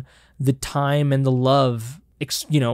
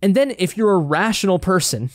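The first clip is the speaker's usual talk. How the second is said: ordinary talk, nothing marked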